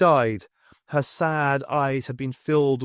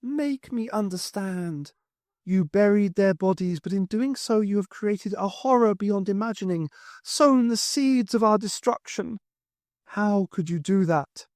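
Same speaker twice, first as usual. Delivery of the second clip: higher woman's voice, rising to a cry on murdered; higher, anguished Highland wife; accusing ghostly wife; 7 semitones higher than usual. Acted. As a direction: confront him with his crimes. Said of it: "the voice was that of Morag".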